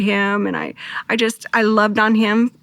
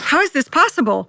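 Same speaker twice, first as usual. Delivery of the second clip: higher, astonished disbelief at her lost son; shocked; 6 semitones higher than usual. Retold or imitated. Mother seeing her dead son at the door, shocked. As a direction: gasp it out as if he's impossibly there.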